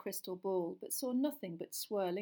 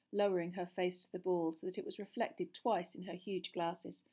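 usual speaking voice

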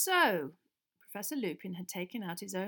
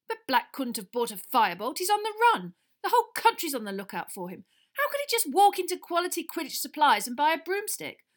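loud, higher protest; heated Wood; 8 semitones higher, 10 dB louder than usual. A narrator's reading. Wood arguing with Harry, loud and heated. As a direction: argue it can't be.

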